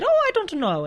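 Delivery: high-pitched imitation of an Indian accent. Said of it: "in his, in his Indian accent".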